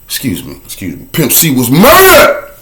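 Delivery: ordinary delivery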